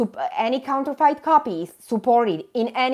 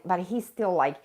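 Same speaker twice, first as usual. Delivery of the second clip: ordinary talk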